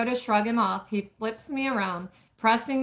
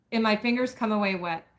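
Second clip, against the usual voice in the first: ordinary delivery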